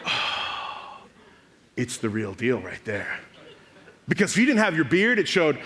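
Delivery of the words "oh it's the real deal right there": breath of an "oh", then low and soft; mock-reverent hush, pitched low; hushed awe. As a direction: react as if deeply impressed. Imagined friend awed by the oath.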